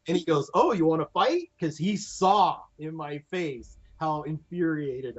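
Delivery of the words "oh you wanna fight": high, playful fighting challenge; mock challenge. Reynolds squaring up to the helmet worker, mock challenge. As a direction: square up with a raised pitch.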